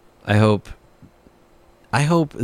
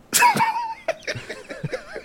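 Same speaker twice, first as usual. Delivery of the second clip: squealing burst of laughter; shrieking high laugh; 6 dB quieter than usual. Real, not acted. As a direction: lose it completely, shrieking. Breaks character laughing.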